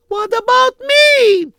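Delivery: squeaky high-pitched woman; slow, high, pleading woman; high woman's voice. Acted.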